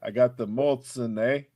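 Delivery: lightly put-on Canadian accent, eh on the end; mock Canadian accent. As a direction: tease with a half-hearted Canadian voice. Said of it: "she's a little Canadian with the A".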